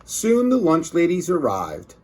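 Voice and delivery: ordinary delivery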